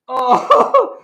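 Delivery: usual speaking voice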